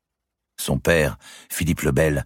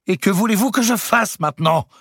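very high, near-breaking whine; shrill voice; 5 dB louder than usual. A narrator's reading. Louis asks his accusing wife, high-pitched. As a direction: plead like a child about to cry. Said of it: "d'une voix aiguë".

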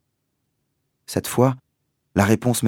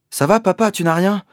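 worried; 13 semitones higher, 6 dB louder than usual. Acted. Worried son at pit edge.

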